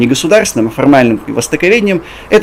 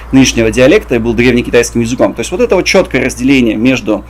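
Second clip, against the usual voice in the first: ordinary talk, nothing marked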